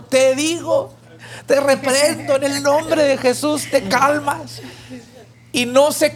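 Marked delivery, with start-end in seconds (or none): 0.0-4.6 high, acted near-tearful command; almost crying. Desperate passenger rebukes the wind a third time, acting almost in tears. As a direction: plead and rebuke as if close to tears. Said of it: "casi llorando".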